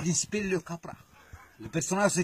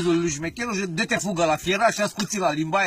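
ordinary talk, nothing marked

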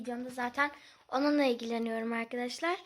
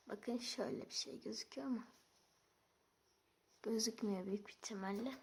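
whispering; 3 semitones lower, 11 dB quieter than usual. Real, not acted. Whispered aside.

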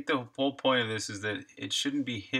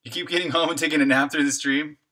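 usual speaking voice

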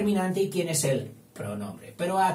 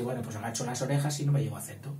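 quick, low, softer giving in; resigned poor little thing; 4 semitones lower, 5 dB quieter, much faster than usual. Acted. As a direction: back down meekly in a hurried undertone. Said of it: "el pobrecito, pues dice".

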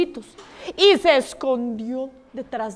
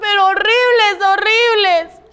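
alarmed chick; 7 semitones higher than usual. Acted. Fifth chick telling of horrible noises, alarmed wail. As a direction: wail it high like a little chick.

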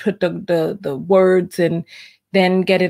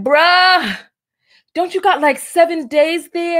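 pitched-up, loud incredulous bro; high mock friend voice; 9 semitones higher, 4 dB louder than usual. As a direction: squeak it out like a shocked friend. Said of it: "the other guy's like".

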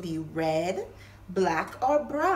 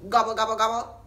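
turkey gobble; 8 dB louder, faster than usual. Pretend. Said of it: "I gabble".

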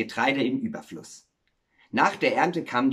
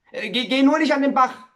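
loud, high, stammered warning; anxious warning; 12 semitones higher, 7 dB louder than usual. Acted. Miller keeps his son from the stream, anxiously warning. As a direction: warn urgently, tripping over the first word. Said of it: "warnte er immer wieder".

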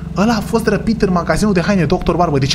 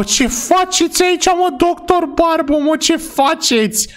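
surprised; 9 semitones higher, slower than usual. Acted. Finds the kidnapped doctor.

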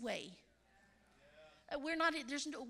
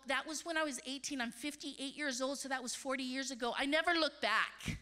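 ordinary talk

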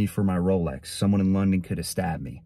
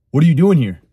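higher, louder challenge at the door; retold confrontation; 5 semitones higher, 8 dB louder, faster than usual. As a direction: demand it like an annoyed homeowner, retold.

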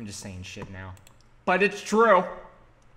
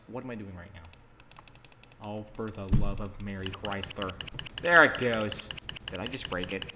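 ordinary talk, nothing marked

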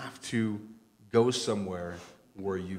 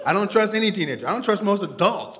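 excited; 10 semitones higher, 10 dB louder than usual. Real, not acted. Laughing rant about teenagers.

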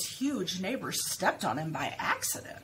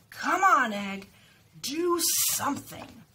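shouting at the egg; 7 semitones higher, 6 dB louder, slower than usual. A narrator's reading.

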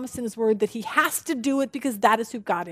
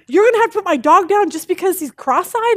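higher and louder, aghast at the vet; outraged disbelief; 6 semitones higher, 6 dB louder than usual. Retold or imitated. Dog owner shocked at the vet's verdict, loud outraged disbelief. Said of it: "And the guy goes".

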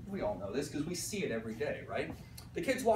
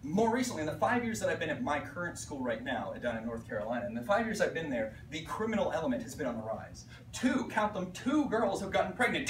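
ordinary talk

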